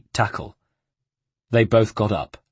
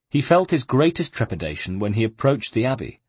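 ordinary delivery

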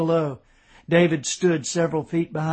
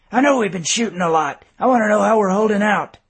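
louder, faster, pressing; impatient; 5 semitones higher, 7 dB louder, faster than usual. A narrator's reading. Colonel presses his brother for an answer, impatient and louder. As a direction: snap back with impatience.

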